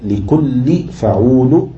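slow, rising foot; metrical scansion. Shown as a demonstration. Teacher pairing word with foot, slow scansion. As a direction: stretch the syllables as you scan.

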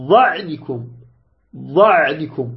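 "ظعنكم" with the ع allowed to flow briefly; careful Ayn. Shown as a demonstration. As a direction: let the ع in "ظعنكم" sound through before the ن. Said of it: "لاحظت مع العين".

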